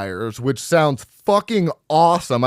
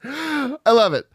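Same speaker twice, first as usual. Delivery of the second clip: delighted; 11 semitones higher, slower than usual. Real, not acted. Delighted by review.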